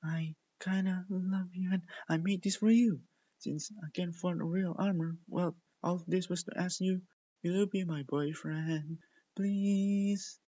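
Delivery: shy confession. Acted.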